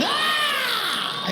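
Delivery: sudden high loud squawk; mock baby bird shriek. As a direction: screech like a starving chick.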